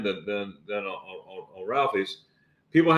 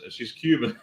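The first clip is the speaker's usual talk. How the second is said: ordinary talk, nothing marked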